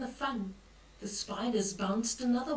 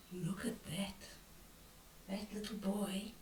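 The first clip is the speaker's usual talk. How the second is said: whispered, much softer; hushed pointing; 3 semitones lower, 11 dB quieter than usual. Pretend. Granny showing children the picture in a whisper. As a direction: share a secret about the picture.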